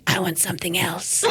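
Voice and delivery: gruff mock toddler demand; raspy little nephew. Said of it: "That's how he talks".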